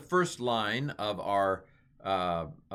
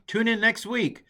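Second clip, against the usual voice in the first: mock announcer; 12 semitones higher, 7 dB louder than usual. Mock broadcaster sign-off.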